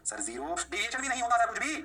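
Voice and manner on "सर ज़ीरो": low, soft pupil voice; student answer. Acted. Student answering sir's slab question, low student answer.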